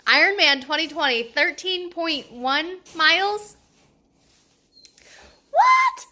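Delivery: rising disbelief to a high yelp; slow, then a shrieking what; shocked. Retold or imitated. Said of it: "And I was like".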